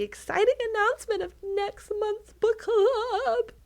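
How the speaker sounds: high, drawn-out mock-grand announcement; hammed-up excitement. Podcast host teasing next month's book to listeners, hammed-up excitement. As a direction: build the suspense, stretching the words high.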